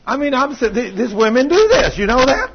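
real high, shouted exclamation; loud, high-pitched preacher's outburst; loud indignation. Real, not acted. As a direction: raise your voice high and loud at the congregation.

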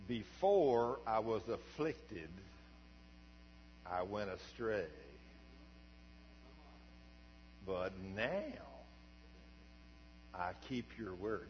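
slow, deliberate Bible reading; scripture reading. Shown as a demonstration. Preacher reads Psalm 119:67 to the church, slow and solemn. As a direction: read the psalm slowly with long pauses. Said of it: "One nineteen sixty-seven".

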